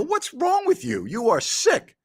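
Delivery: high-pitched mock outrage; retelling his shock, voice shooting up high; retold appalled reaction. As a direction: scold a friend in high, appalled disbelief. Retold appalled scolding of friend.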